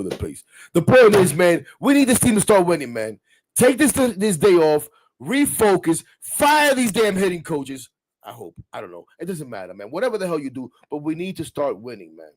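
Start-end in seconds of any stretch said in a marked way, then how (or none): 0.7-7.9 loud, fired-up rant about the team; fan rant. Real, not acted.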